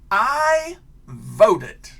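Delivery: loud, high and proud; proud sticker words. Acted. Teacher reading out the sticker's words to the kids, loud and proud. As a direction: announce it like a badge of honour. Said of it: "And that sticker will say".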